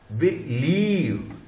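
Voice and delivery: Believe drawn out slowly with the weight on लीव; stress on LIEV. Shown as a demonstration. Teacher to class, slow and stressed. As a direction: lean into the second syllable of believe. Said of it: "ये stress syllable हो गया".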